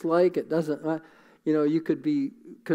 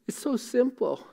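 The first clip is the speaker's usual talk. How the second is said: emotional; 6 semitones higher, 4 dB quieter than usual. Real, not acted. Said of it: "I get emotional when I talk about this".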